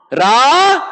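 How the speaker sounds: Ra called high and long, then Ma after a pause; syllable by syllable. Shown as a demonstration. Preacher leads the class saying Rama, high separated syllables. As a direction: split Ra-ma into two held calls. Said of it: "just by saying Rama".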